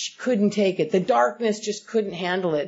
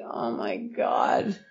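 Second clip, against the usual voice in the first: gasping aside; 10 semitones lower, 5 dB quieter, much slower than usual. Real, not acted. Aside in sermon-like talk.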